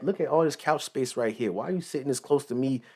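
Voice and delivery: usual speaking voice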